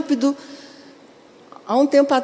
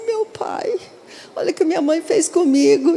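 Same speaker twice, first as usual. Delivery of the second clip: high-pitched mock lament; melancholic child; 5 semitones higher than usual. Melancholic child lamenting what mother did, hammed-up hurt. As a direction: imitate a wounded, melancholic child. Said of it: "o melancólico vai ficar assim".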